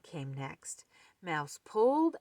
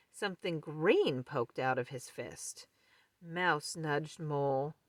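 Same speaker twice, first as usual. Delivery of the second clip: ordinary delivery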